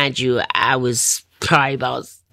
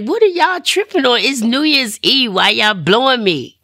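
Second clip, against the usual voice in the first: high, indignant retold protest; indignant disbelief; 8 semitones higher, 3 dB louder than usual. Woman to dealer and his wife on New Year's Eve, indignant. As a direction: push the pitch up, acting out disbelief.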